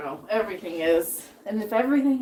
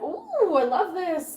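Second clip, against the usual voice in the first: imitating her delight; 8 semitones higher, much slower than usual. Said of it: "and she's like".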